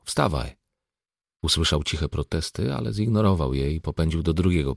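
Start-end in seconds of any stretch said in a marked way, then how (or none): none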